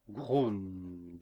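loud, drawn-out grunt as a word; bear growl. A narrator's reading. Tom the bear answering the coachman, growling.

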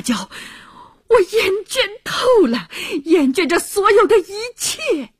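high, loud, insulted tirade; furious outburst. Acted. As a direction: explode at a superior, fed up with everything. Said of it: "大发雷霆".

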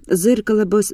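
ordinary delivery

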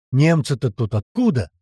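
ordinary delivery, nothing marked

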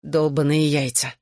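slow, groggy groan of a curse; thick-tongued moaning; moaned curse. A narrator's reading. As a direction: drag the curse out woozily. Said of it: "простонал в ответ Джонни".

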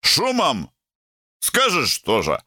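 put-on high, slurring drunk reply; slurred drunk. A narrator's reading. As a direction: slur it like a tipsy young man. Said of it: "заплетающимся языком". (заплетающимся языком)

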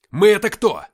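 high, very loud bark; barked question. A narrator's reading. Captain on radio outshouting battle noise, barking. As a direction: yell over the battle noise. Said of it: "рявкнул я, пытаясь переорать шум боя".

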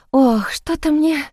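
high, breathless feigned swoon; theatrical fainting. Acted. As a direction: fake a faint, trailing off. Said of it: "театрально упала в обморок".

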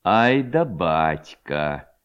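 low, very slow, drawn-out exclamation; wry amazement. A narrator's reading. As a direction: stretch it out in low wonder. Levko amazed at his father. (протяжное изумление низким голосом)

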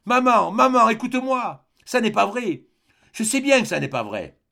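high, loud pleading that eases at the end; desperate pleading. Acted. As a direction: beg her loudly, then settle. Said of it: "Il répétait". (supplication forte et aiguë)

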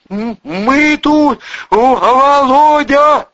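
high, loud stammering cry; pitiful shout. A narrator's reading. Soldier answers Volodya in a pitiful voice, shouting. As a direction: call back loudly, stuttering and pathetic. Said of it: "закричал армеец".

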